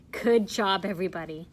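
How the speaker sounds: plain reading voice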